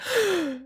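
sharp high gasp; quick, soft, high-pitched gasp; mock gasp. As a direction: imitate a shocked intake of breath. Acting out the patient's reaction.

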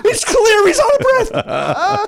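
very high-pitched, hammed-up comic rant; exasperated shouting. Comedian calling out the lying announcer, exasperated high shouting. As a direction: shriek in mock disbelief at an obvious lie. Mocking the announcer's obvious lie.

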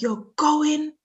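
imitating a cousin's high insistence; mock shout. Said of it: "She started shouting".

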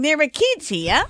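retold bystander protest. Radio host quoting people in the video, mock protest. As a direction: imitate an appalled onlooker, slowly. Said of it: "People in the video are saying".

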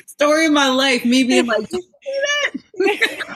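high laughing voice; laughing at herself, high. Real, not acted. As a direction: talk through real laughter. Laughing about her own story.

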